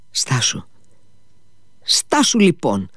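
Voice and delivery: understated agitation, pitch rising on the repeat; agitated. A narrator's reading. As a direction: cut in, mildly flustered. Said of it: "τον διέκοψε ταραγμένος ο Αλιώσα".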